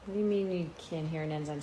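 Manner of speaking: ordinary delivery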